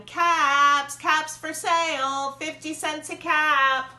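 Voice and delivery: loud, slow street cry up high; drawn-out hawker's cry; peddler calling out. A narrator's reading. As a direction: call out your wares to the whole street.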